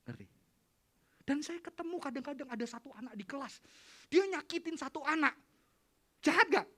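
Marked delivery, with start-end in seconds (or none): none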